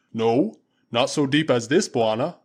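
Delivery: loud, slow, scornful warrior; booming Waziri warrior, slowed. Acted. Usula mocking the impostor to Tarzan, loud and slow. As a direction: drag it out with relish.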